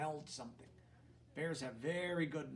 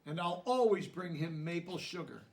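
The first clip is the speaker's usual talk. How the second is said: gentle storybook reading, a touch higher; lively picture-book narration; reading to children; 5 semitones higher, 7 dB louder than usual. A narrator's reading. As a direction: read it like a bedtime story to kids.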